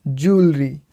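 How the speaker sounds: ज्वेलरी as "JOOL-ree", emphatic; correct pronunciation. Shown as a demonstration. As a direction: say ज्वेलरी in two syllables, long "oo". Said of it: "आप इसको सही तरीके से अटर कीजिए". (ज्वेलरी को 'जूलरी' बोलें)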